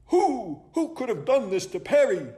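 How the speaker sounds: high hoots into a hurried question; hoot, then blurt the question; high-pitched baffled owl outcry; owl's cry. Acted. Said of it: "Hubert cried".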